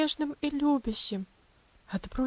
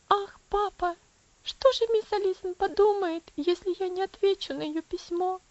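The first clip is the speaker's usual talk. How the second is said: put-on high girl's voice, exclaiming; crying out, sobbing; 8 semitones higher, 3 dB louder than usual. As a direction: cry out to papa in distress. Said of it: "воскликнула Элси с горькими рыданиями". (высоким голосом, с горьким плачем)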